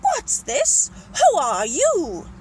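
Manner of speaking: loud, high start, slow incredulous question; astonished demand. Acted. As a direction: demand answers, utterly staggered. Staggered Duke confronts intruders.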